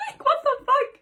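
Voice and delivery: high-pitched, laughing incredulity; laughing disbelief. Real, not acted. Reader reacting to a steamy passage, laughing in disbelief. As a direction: squeal it through laughter.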